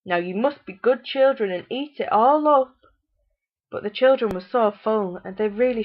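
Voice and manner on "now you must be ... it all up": high, loud sugary pleading; disappointed mother. A narrator's reading. Disappointed mother urging full children to keep eating. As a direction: coax them with fake sweetness. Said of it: "very disappointed with them".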